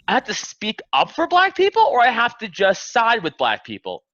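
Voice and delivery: high incredulous rising question. Retold or imitated. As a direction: play it as baffled disbelief. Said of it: "I go".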